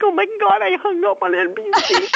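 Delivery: high, shocked gasp of disbelief; shocked realization. Retold or imitated. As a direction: squeal it out in mock horror. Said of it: "I'm like".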